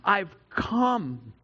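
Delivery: very slow, weighty and deliberate; solemn voice of Jesus. Retold or imitated. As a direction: let each word land slowly, like Jesus declaring his purpose. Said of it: "he's also gonna say".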